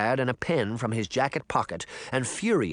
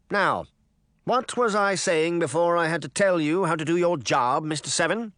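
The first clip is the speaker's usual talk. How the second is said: higher, pompous and condescending; a smug politician talking down; pompous mayor voice; 6 semitones higher, 3 dB louder than usual. A narrator's reading.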